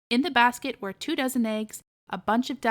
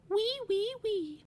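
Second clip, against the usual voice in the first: high squeaky piglet wee-wee, much higher; little pig's wee wee; 10 semitones higher, 6 dB quieter, slower than usual. Acted. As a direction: sing it like a happy little pig. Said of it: "singing little songs to himself and grunting".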